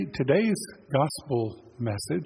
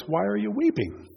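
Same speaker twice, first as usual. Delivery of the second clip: Jesus asking; 8 semitones higher than usual. Retold or imitated. Risen Lord asks weeping Mary why, higher voice. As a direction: question lifted noticeably higher. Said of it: "And he says".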